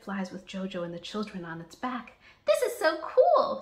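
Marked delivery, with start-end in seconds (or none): 2.5-3.6 very high, loud delighted squeal; excited screech. A narrator's reading. Cody riding on Chip's back, high excited screech. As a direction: squeal it like a thrilled kid. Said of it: "screeches Cody".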